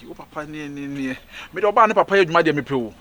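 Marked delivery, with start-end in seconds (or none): 1.5-2.9 fast, loud shout of desire; shouted fast boast; loud declaration. Acted. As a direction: blurt it out loud and quick.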